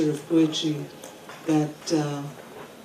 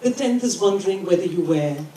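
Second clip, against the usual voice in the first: measured poetry recital with deliberate pauses; poem reading. Acted. Poet reading a poem about poems to an audience, measured recital. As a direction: read it in short phrases, pausing after each. Reads own poem aloud.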